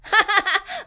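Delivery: short mischievous laugh; sly chuckle. Acted. Character plotting against the kid, sly chuckle.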